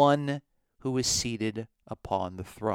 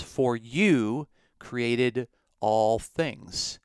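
"you" leaned on, the whole line slowed right down; stress on you; much slower than usual. Shown as a demonstration. Teacher reading the verse with weight on "you". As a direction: drag it out, landing on "you". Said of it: "it's hard to get this emphasis".

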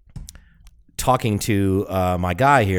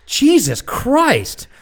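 high, loud, drawn-out exasperation; exasperated mocking; 10 semitones higher, 4 dB louder, much slower than usual. Real, not acted. Co-host mocking a rambler's compass confusion, exasperated. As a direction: rattle off options in a high, mocking whine. Mocking co-host's search for direction.

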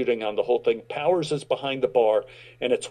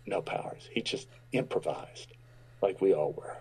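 soft whisper, much lower; whispered correction; 7 semitones lower, 9 dB quieter than usual. Retold or imitated. Explaining to Powers on set in a whisper. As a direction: whisper it so nobody else hears.

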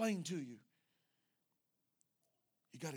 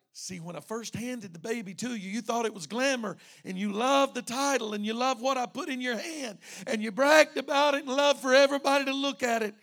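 building from raised voice to loud, high reproach; impassioned preaching; 9 semitones higher, 15 dB louder than usual. Acted. Pastor reproaching whoever he handed the baby to, building preaching. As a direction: preach it harder with each clause.

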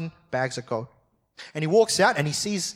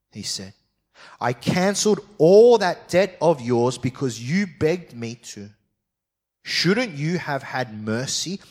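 preacher reading scripture aloud; 3 semitones higher than usual. Acted. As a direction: read the Bible passage out to the congregation. Reads part two aloud.

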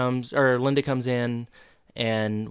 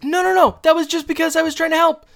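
very high, loud, quick squeal; mock frantic protest; 7 dB louder, faster than usual. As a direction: imitate a panicky self-defence at top pitch. Retold frantic self-defence.

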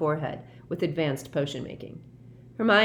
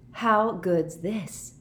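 enthusiastic; 4 semitones higher, much slower than usual. A narrator's reading. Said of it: "said Harry enthusiastically".

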